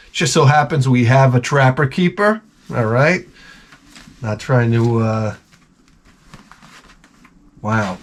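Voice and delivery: ordinary talk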